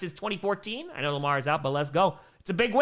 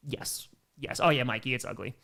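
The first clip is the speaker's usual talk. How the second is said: ordinary talk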